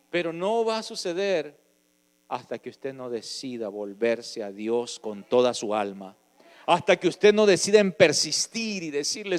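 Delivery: loud, high, impassioned preaching; preaching at full force. Acted. Preacher urging the congregation to beg God for mercy, shouting. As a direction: cry it out to the congregation like a revival preacher.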